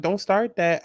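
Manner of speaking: ordinary talk, nothing marked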